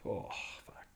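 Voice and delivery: breathless whispered curse, holding back tears; barely voiced, overcome; whispered swearing. Real, not acted. Runner alone on camera, whispering through tears. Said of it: "I am getting teary".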